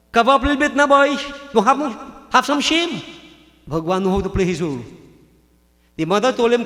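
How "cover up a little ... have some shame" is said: mock-scolding, higher and louder; teasing scold. Krishna teasing Duryodhana about going naked, higher and louder mock scold. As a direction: chide him to cover up, higher and louder.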